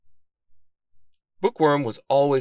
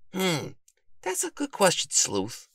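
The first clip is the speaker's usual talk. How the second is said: high-pitched bookish character voice; pondering bookworm; 11 semitones higher than usual. A narrator's reading. Bookworm scratches his chin over the question, pondering. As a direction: muse it in a high voice.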